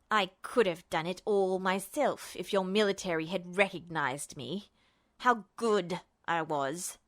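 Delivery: low, soft, unstable villain voice; Justin's bitter grievance. Acted. Justin bitterly recalls rejection, low and shaky. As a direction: let the resentment shudder through.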